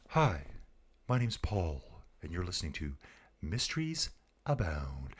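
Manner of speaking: a hint of a hushed mystery-show host; imitating podcast host. Streamer imitating the Mysteries Abound host, softly. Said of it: "and he talked like this too".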